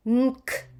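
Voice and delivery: short hummed N leading into K. Shown as a demonstration. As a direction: blend N into K at a moderate speed. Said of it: "say it a bit faster".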